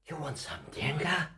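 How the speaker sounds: soft coaxing whisper. Acted. Offering Trump a treat, in a coaxing whisper. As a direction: tempt him in a hush.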